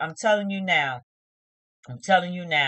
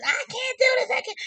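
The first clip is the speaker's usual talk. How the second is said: mock freak-out. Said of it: "she got up swinging her arms".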